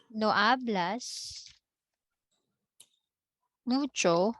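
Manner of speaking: drawn out, with a long gap before mucho; slow dictation. Shown as a demonstration. Teacher quizzing students on what the phrase means, slow dictation. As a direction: give the phrase slowly in two parts.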